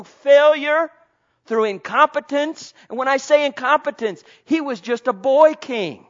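loud, high preaching climb; preaching at full force. Acted. Preacher rousing the congregation, loud and high. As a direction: lift the congregation with fervent preaching.

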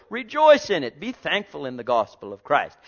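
ordinary delivery

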